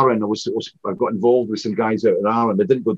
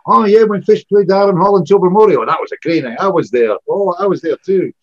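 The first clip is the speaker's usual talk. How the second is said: a bit higher and louder, a hint of proud, gushing locals; gushing local fans; 7 semitones higher, 6 dB louder than usual. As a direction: playfully mimic fans boasting they were there. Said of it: "It's like, like I said".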